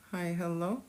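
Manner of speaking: usual speaking voice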